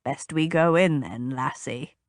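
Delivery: put-on low old man voice; low rumble. A narrator's reading. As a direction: rumble it like a gruff grandfather. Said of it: "his father rumbled".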